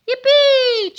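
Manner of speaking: high, loud joyful cheer. A narrator's reading. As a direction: whoop with delight. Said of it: "cheered the crowd of people".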